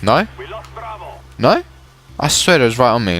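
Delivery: ordinary talk, nothing marked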